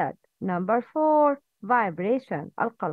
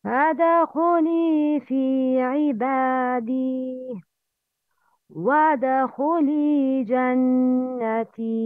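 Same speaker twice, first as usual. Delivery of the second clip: Quran recitation. Acted. Teacher recites Quran before lesson.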